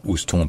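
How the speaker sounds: "Houston" as ous-ton with a nasal ending; French spelling reading. Shown as a demonstration. Teacher showing the tempting French misreading, French reading. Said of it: "on est tenté de lire ça".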